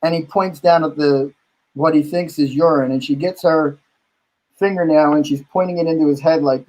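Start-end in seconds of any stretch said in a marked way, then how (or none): none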